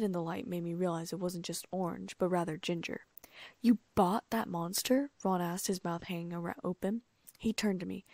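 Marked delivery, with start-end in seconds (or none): 3.6-5.1 slow, stunned and a little louder; can't believe the monster cat; shocked disbelief. Acted. Ron gaping at the cat, slow stunned disbelief.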